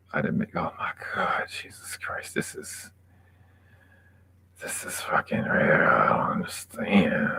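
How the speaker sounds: hushed disbelieving whisper; slow unvoiced whisper of shock; stunned whisper. Real, not acted. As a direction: breathe it out in disbelief.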